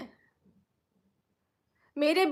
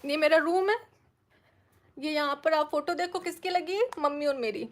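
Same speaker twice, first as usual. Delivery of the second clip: usual speaking voice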